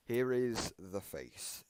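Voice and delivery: slow, loud, drawn-out announcement; dramatic reveal. Real, not acted. Streamer unveiling the face animatronic to viewers, slow loud dramatic reveal. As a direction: unveil it like a showman, word by word.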